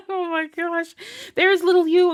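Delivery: high, laugh-lifted exclamation; laughing gush. Real, not acted. Reader delighted for her listener, gushing high through laughter.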